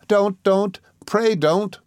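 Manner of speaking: high, pleading cry. A narrator's reading. Dora begs her fiancé to stop talking of poverty, crying out. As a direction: beg him to stop in a high voice. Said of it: "cried Dora".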